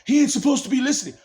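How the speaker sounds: mock-imitating the noise that catches him listening; taunting voice. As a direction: jeer at him like a gloating onlooker. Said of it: "a fucking noise says".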